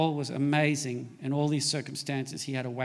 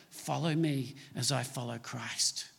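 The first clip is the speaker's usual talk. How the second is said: quiet and lower, gently spoken; "Follow me as I follow Christ" said softly, low and intimate, almost under the breath; soft, hushed quote; 5 semitones lower, 7 dB quieter than usual. Retold or imitated. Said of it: "he says to the church".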